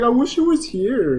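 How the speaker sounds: high, slow, pining; mock longing. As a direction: sigh it like a lovesick friend. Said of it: "You're like".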